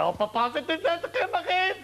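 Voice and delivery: put-on high-pitched wail of a weeping little girl; hammed-up, climbing sobby whine; mock tearful child. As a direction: imitate a daughter crying to her papa. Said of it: "tout en pleurs, elle me fait".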